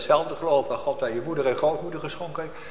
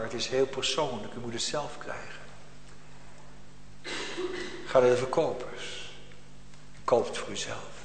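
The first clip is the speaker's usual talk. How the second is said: softer, fading earnest plea; soft appeal; 8 dB quieter than usual. Acted. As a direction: draw them in quietly. (zacht en indringend)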